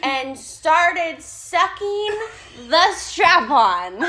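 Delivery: slow, loud, deliberate reveal; drawn-out punchline. Real, not acted. Storyteller dropping the curveball on her friend, drawn-out reveal. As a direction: stretch out each word for the punchline.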